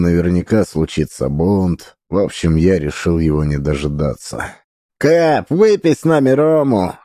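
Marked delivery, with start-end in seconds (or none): 5.0-6.9 high rowdy pirate shout; drunken shout. A narrator's reading. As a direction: yell it like a drunk crew. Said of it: "кричали со всех сторон пьяные пираты".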